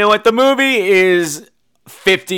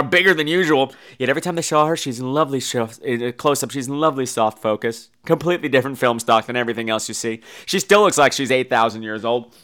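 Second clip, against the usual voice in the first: ordinary delivery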